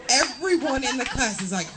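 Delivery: usual speaking voice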